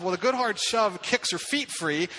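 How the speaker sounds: ordinary delivery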